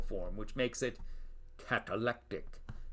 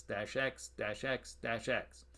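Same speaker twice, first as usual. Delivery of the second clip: usual speaking voice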